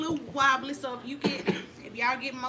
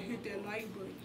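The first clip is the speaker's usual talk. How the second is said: low, much quieter put-on man's voice; mock male voice; 5 semitones lower, 12 dB quieter than usual. Shown as a demonstration. Asking viewers to like, in a put-on man's voice. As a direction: drop into a deep dude voice for the ask. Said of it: "They need to hear a male voice like".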